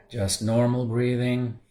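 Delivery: soft, calm meditation guide voice; soft guiding voice settling into the body scan; guided meditation. Acted. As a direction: ease them into stillness quietly. Leads a meditation.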